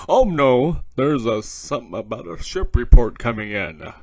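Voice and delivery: ordinary talk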